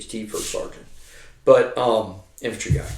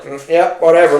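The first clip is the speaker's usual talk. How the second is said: dismissive; 5 semitones higher, 7 dB louder than usual. Retold or imitated. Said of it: "or I'd just be like".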